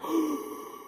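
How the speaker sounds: sharp high gasp of alarm; imitated shocked gasp. Retelling the audience's reaction, a high imitated gasp. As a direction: gasp like the audience thought she'd die. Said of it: "everyone was like".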